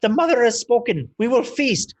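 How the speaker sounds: high, loud goblin declaration; the goblin chief announcing the feast; goblin proclamation. Acted.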